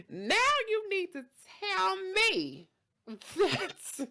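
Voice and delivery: high, slow, drawn-out outrage; acted indignant demand. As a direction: demand it like a hammed-up offended diner. Customer demands an explanation.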